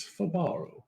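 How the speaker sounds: Fibaro as fuh-BAR-oh; stress on second syllable. Shown as a demonstration. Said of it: "Not the Italian version".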